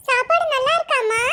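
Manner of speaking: ordinary talk, nothing marked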